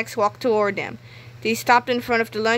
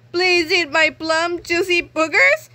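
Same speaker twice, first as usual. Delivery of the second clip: giggly principal; 8 semitones higher, 3 dB louder, slower than usual. A narrator's reading. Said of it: "giggling wistfully".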